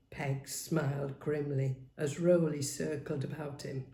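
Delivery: ordinary delivery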